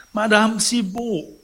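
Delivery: high, loud, drawn-out call. A narrator's reading. Sick man calls his housekeeper back, long high call. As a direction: call slowly for someone next door.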